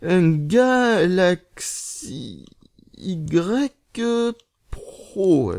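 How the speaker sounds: syllable-by-syllable, stretched and halting; typing aloud. Real, not acted. Vlogger typing the phone's name into a tweet, slow dictation. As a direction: drag out each piece as you key it in.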